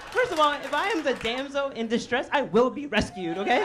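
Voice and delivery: high, loud comic declaration; mock indignant insistence. Comic justifying being rescued first, high indignant insistence. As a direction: insist on it as an entitled damsel.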